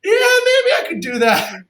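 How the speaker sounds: high, slow, reluctant client voice; high hesitant client. Retold or imitated. Client slowly agreeing to meet, high hesitant voice. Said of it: "They'll say, like".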